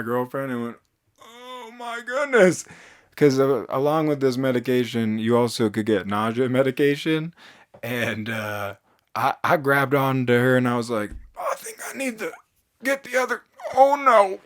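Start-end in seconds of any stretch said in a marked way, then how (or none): 1.2-2.6 slow, much higher gasp of alarm; mock panic. Grabbing his girlfriend as the drugs hit, high slow alarm. Clutching girlfriend, medicine hitting. 11.4-14.4 mock panic. Telling his girlfriend he's about to be sick, panicky and much higher. Nausea hitting, grabbing girlfriend.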